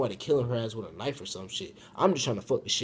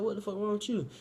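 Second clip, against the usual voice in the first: startled question; 10 semitones higher than usual. Retold or imitated. Retelling his startled question to her.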